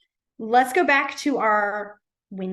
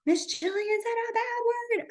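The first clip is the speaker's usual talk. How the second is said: put-on tattling kid voice; 12 semitones higher, 3 dB quieter than usual. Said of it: "They're gonna be like".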